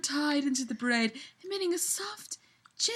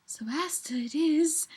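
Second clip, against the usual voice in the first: unvoiced, soft elf woman; whispered elf line; 3 semitones higher, 6 dB quieter than usual. Elf woman tells Loki her nickname, whispered. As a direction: breathe it tenderly.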